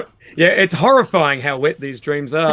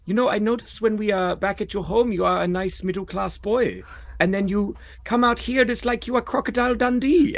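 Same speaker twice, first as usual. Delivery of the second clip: higher-pitched mock German accent; German comic accent; 6 semitones higher than usual. German comic teasing an Australian abroad, in a high German accent. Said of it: "I'm gonna do his".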